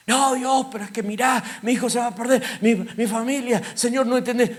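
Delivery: high, loud, frantic protest to God; pleading believer. Acted. Worried believer arguing with God about his family, high and pleading. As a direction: plead desperately, voice pushed high.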